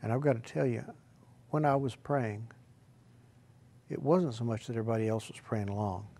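ordinary talk, nothing marked

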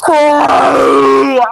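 loud, drawn-out groan of frustration; frustrated cry. Real, not acted. Groaning over almost killing Andrew, frustrated cry. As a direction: cry out over a near miss.

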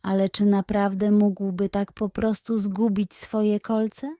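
usual speaking voice

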